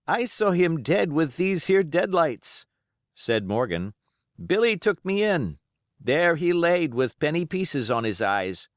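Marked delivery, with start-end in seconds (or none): 0.1-2.7 higher, louder, slow, awed pirate; high, hushed-awe old sailor; Morgan. Acted. Morgan fearful tale. 4.4-8.7 put-on high, hushed-awe old sailor; Morgan. Morgan continues.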